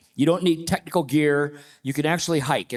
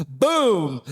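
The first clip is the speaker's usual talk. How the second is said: higher, drawn-out, punchy exclamation; triumphant boom; 4 semitones higher than usual. Retold or imitated. Climber relishing his new nickname from the guide, triumphant. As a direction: let out a pleased, booming exclamation.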